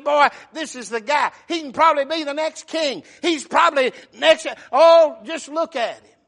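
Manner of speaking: loud, high preacher's burst of admiration; proud fatherly excitement. Retold or imitated. Preacher voices David admiring young Absalom, proud loud excitement. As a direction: gush at full volume over a favorite son.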